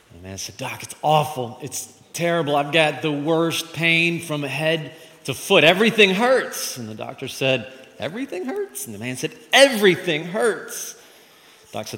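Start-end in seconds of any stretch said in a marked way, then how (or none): 0.6-6.8 higher, drawn-out agonized complaint; hammed-up suffering patient; complaining patient. Joke patient complaining of pain. 8.0-8.8 high-pitched questioning echo of EVERYTHING HURTS; doctor repeating it back in disbelief; puzzled, high rising query; incredulous question. Acted. Doctor echoes patient in disbelief. 9.5-11.0 loud, high, drawn-out EVERYTHING HURTS; hammed-up pained wail; emphatic complaint. Patient insists everything hurts.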